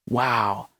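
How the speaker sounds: low, soft mock amazement; underwhelmed, deadpan fake wonder; sarcastic awe. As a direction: feign being impressed, quietly and low. Sarcastic reaction to emoji posting.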